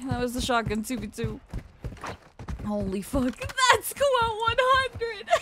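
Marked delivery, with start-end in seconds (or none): 3.4-5.4 high, slow laughing reaction; teary laughter. Real, not acted. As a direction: laugh it out through tears. Tearful laugh at donation.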